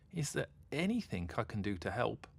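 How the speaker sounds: usual speaking voice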